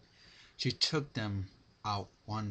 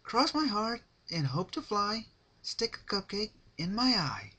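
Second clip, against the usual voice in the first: high, louder singsong pony oath; Pinkie Promise rhyme; 13 semitones higher, 6 dB louder than usual. Acted. Pinkie seals her promise to Rarity, singsong. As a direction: chant the oath like a playful vow.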